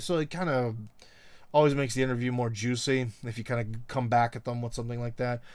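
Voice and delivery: usual speaking voice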